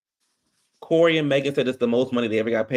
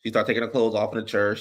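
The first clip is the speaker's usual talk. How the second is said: normal talking voice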